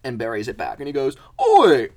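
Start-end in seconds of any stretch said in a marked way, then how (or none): 1.4-1.9 very loud, high-pitched yelling; hammed-up squabbling thug; outraged mole. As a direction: shout back in wounded outrage. Second mole stabbed, quarrel escalates.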